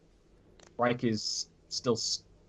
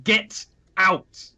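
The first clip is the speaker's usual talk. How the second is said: raised, higher-pitched mock yell; mild yell; 6 semitones higher, 4 dB louder than usual. Player voicing Stalbor yelling at someone, mild yell. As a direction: yell it in character, not at full force. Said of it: "Stalbor yells".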